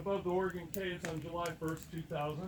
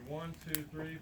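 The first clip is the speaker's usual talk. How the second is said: ordinary delivery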